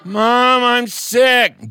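put-on high, loud whining kid; whiny child. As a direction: whine that you're sick. Child faking sickness, whining.

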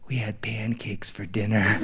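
low, soft drowsy answer; hushed bedtime voice of a small boy; sleepy child. Retold or imitated. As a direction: murmur it half asleep.

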